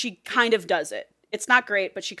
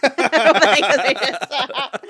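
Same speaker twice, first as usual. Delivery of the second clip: slow, higher, theatrical; dramatic read; 6 semitones higher, 10 dB louder than usual. Retold or imitated. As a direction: ham up the line. Repeat acted.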